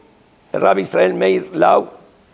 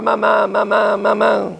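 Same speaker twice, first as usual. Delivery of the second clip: put-on nagging child. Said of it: "volviéndola loca".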